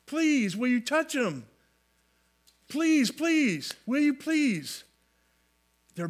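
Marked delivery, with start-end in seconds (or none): 0.0-4.8 higher-pitched, drawn-out acted pleading; imploring on someone's behalf; begging. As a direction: plead on each "please", pitch swooping down. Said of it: "They were saying, begging Jesus".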